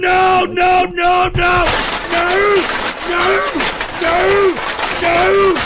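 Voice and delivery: rising frantic shouts of no; panicked angry yelling; frantic no's. Real, not acted. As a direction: yell no over and over to stop it. Host yelling at a playing clip.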